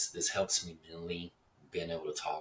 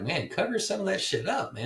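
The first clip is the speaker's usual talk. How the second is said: much higher, louder plea of disgust; disgusted outcry; 13 semitones higher, 4 dB louder than usual. Real, not acted. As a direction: recoil and beg her to cover up. Disgusted at stranger's belly.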